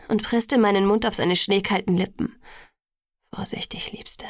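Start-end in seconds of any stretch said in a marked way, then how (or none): 3.3-4.2 very soft, muffled murmur; hushed, intimate caution. A narrator's reading. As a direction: murmur it tenderly, barely voiced. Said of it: "murmelte er unter meinem drängenden Kuss".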